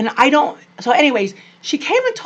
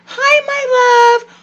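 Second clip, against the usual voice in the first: high, slow, syrupy "Hi, my love"; sugary grandma greeting; 6 dB louder, slower than usual. Retold or imitated. Mimicking her mom cooing at the grandkids, sugary. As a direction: coo it like a doting grandma. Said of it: "She never told us that".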